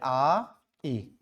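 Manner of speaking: "आ, ई" as two separate vowels, the first drawn out; split diphthong. Shown as a demonstration. Teacher showing two vowels in पाई, "आ, ई" said separately. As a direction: hold "आ", then give "ई" on its own. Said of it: "देखो दो sound आ रहे हैं".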